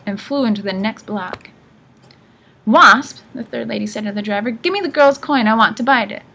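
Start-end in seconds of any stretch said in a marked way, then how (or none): 2.6-3.2 loud, raised name call; high, loud old-woman demand. A narrator's reading. Old sisters squabbling, calls driver. 4.5-6.2 demanding. A narrator's reading. Old sisters squabbling over coin.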